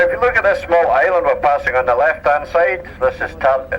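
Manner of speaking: usual speaking voice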